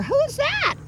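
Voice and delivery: very high, squeaky question; excited dog talk. Real, not acted. Trainer pointing passers-by out to dog, excited dog talk. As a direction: pitch the question high and thrilled.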